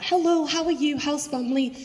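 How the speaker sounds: high, sing-song mock greeting; imitating friendly greeting. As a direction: greet a customer with exaggerated friendliness.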